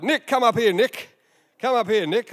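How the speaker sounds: loud, high call across the room. Real, not acted. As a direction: call him up to the stage. Calling a man to the stage.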